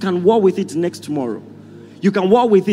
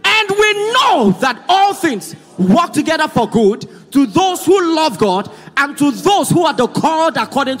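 raised, measured scripture declaration; congregational reading aloud; 5 semitones higher, 3 dB louder than usual. Real, not acted. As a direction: proclaim it so all can join in. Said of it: "Read together with me".

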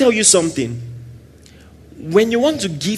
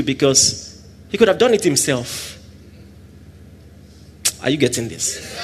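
ordinary talk

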